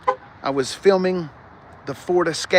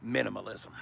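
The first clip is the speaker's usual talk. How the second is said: minimalism muttered much softer and lower, under the breath; muttered aside; 7 semitones lower, 16 dB quieter than usual. Real, not acted. Dealer mocking the sparse dash to himself, muttering. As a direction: drop minimalism to a wry mumble.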